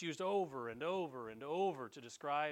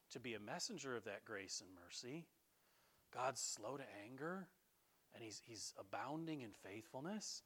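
softer, musing to himself; inner thought; 3 semitones lower, 7 dB quieter than usual. Retold or imitated. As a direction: think it through quietly in his head. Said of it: "in his little mind".

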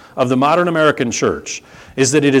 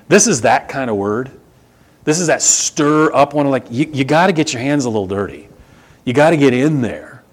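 usual speaking voice

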